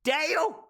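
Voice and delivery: "Dale" as one syllable, high and rising; triumphant correct pronunciation. Shown as a demonstration. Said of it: "That's how you pronounce it".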